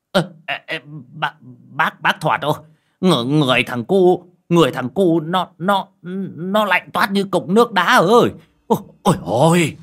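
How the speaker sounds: high, loud panicked stammer; words tumbling out in fear; trembling, frightened. Acted. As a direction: neighbor panicking at the ice-cold body. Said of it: "Lão hói nói bằng giọng run rẩy sợ hãi".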